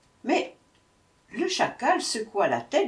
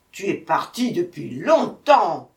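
loud tiger growl; 8 dB louder than usual. A narrator's reading. Hungry tiger to the returning brahman, growling. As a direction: growl it out with force. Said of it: "grogna le tigre".

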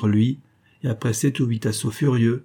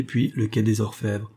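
ordinary delivery

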